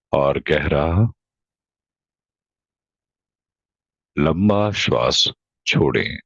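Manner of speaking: low, slow meditation voice with long pauses; guided meditation. Real, not acted. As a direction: guide them slowly into deep, even breathing. Leads a breathing meditation.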